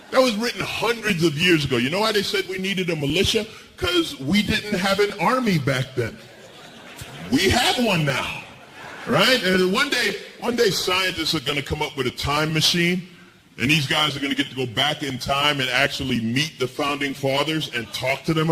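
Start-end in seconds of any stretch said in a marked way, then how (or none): none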